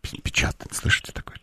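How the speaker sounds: hushed whisper while she types. Real, not acted. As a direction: whisper so the operator can't hear. Listening to operator typing.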